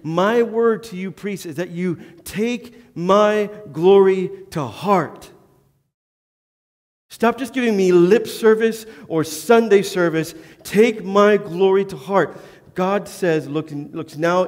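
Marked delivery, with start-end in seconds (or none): 0.0-5.3 higher, louder, slow commanding voice of God; God's word to priests. Retold or imitated. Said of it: "what he told the priest". 7.1-12.4 higher and louder, pressing the point; forceful preaching. Acted. Preacher drives the point home.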